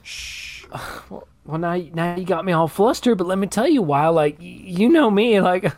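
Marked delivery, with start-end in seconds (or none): 0.0-0.7 a soft breathy shh; quiet shushing sound; whispered shush. Acted. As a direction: hush the room. [name] shushes.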